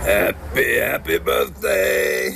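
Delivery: imitating an island radio announcer; drawn-out announcer greeting. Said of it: "this is what he said every day".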